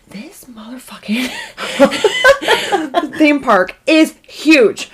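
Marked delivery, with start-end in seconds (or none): 0.1-1.3 hushed, breathy aside; sneaky whispered cuss; whispered curse. Real, not acted. Whispered aside in ride story. 1.3-3.1 sudden high laugh; giggling high laugh; high burst of laughter. Real, not acted. Laughs at huge theme park.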